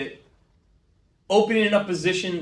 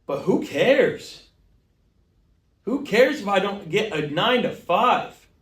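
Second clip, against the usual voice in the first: heated rant; 5 semitones higher, 3 dB louder than usual. Acted. Ranting reply to brother.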